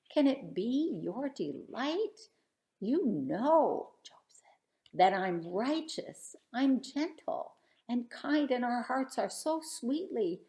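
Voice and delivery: lively sing-song storybook reading; rhythmic picture book read-aloud; reading a rhyming story aloud. Acted. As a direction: read the verses to a room of kids. Reads Job's plea to God.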